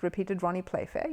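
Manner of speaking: usual speaking voice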